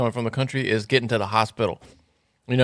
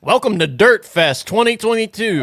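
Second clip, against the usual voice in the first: high-pitched event emcee welcome; mock festival announcer; 9 semitones higher than usual. Co-host mocking the small-town fest, hyped announcer.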